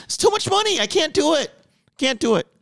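high whiny mock complaint. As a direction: whine it up high, half laughing. Mock poor driver complaining.

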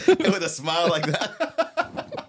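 laughing through the words, slow and high; giggling over the joke. Real, not acted. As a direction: crack up as you say it. Laughing mock description.

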